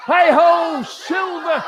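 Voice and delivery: high ringing heroic shout; a mighty cowboy yell to his horse; mighty cry. Acted. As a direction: bellow it to the horizon like a cowboy hero. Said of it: "With a mighty cry of".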